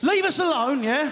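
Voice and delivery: half-laughing snap at unwanted company; mock snap. Comic voicing Brits fleeing chatty tourists, mock snap.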